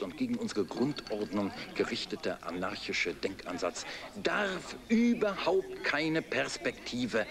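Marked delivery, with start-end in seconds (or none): 4.2-6.2 ranting emphasis. Acted. Politician rant peaks.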